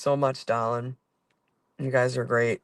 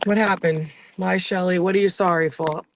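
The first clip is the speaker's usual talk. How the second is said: higher, coaxing voice for the dog; cooing at Shelly, higher and louder; pet talk; 5 semitones higher, 4 dB louder than usual. Real, not acted. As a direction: coo a question to your pet.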